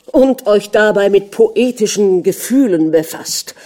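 loud, mocking jab from a jealous husband; ironic. A narrator's reading. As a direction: needle her with heavy irony. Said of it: "sagte der Fürst ironisch".

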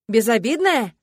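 loud incredulous echo. Acted. Жених заело, нарастает. (громко, не веря)

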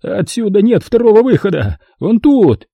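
frantic boss screaming at his men; истеричный визг; hysterical shriek. A narrator's reading. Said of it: "Истерично взвизгнул".

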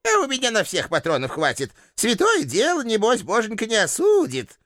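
put-on shrill-free high old woman's rebuke; old landlady. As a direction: shoo him off like a cranky granny. Landlady with gun.

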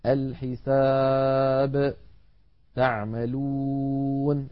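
الحساب and تعملون drawn out, stopped on a still final letter; pausal recitation. Shown as a demonstration. Teacher recites the pause examples very slowly. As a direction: chant each word slowly and stop. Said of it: "نحو".

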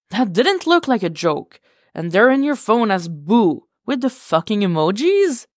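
not buying it for a second; incredulous pushback. Acted. Con grilling Tim about the flirty text, incredulous. Con doubting Tim's excuse.

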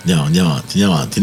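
usual speaking voice

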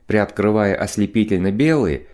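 ordinary delivery, nothing marked

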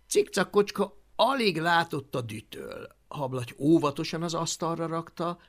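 plain reading voice

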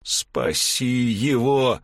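slow, growled command; growled demand. Acted. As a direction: growl it out through rage. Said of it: "зарычал Стейн".

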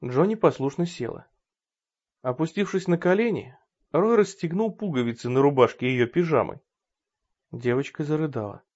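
ordinary delivery

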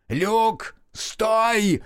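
высокий громкий крик; заорать, останавливая. A narrator's reading. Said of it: "заорал Мерл". (Мерл орёт на Люка)

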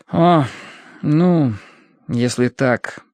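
hesitant, halting concession; reluctant mumble. A narrator's reading. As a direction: give in reluctantly, word by word. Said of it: "промямлил он с неохотой". (неохотно, запинаясь)

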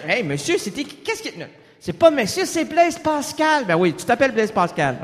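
ordinary talk, nothing marked

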